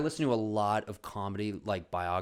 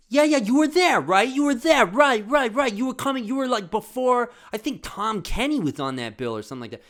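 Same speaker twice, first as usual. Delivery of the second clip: high, loud excited Maron lisp; Marc Maron impression; 14 semitones higher, 13 dB louder than usual. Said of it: "he's like, he's like".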